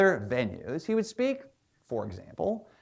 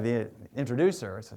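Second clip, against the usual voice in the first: ordinary talk, nothing marked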